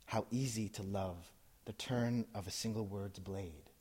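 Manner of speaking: ordinary delivery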